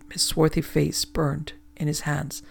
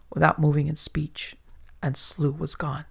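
plain reading voice

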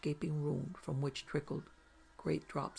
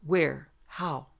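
sharp, louder demanding questions; urgent questioning; 7 dB louder, much slower than usual. A narrator's reading. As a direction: press the spirit for answers. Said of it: "asked the conjurer".

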